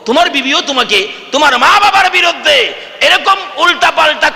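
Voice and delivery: pleading sermon shout, pitched high; fiery preaching. Acted. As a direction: thunder the warning at the crowd. Preacher's impassioned admonition.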